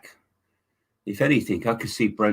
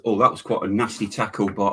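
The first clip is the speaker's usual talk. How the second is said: ordinary delivery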